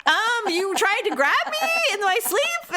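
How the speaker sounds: high, slow, pointed disbelief; incredulous explaining. Retold or imitated. Wife telling husband what he just did, incredulous explaining. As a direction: spell it out slowly in a high, questioning voice. Wife's incredulous answer, retold.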